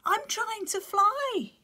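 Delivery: very high, loud, slow chick shout. Acted. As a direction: yell it while bouncing. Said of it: "he shouted".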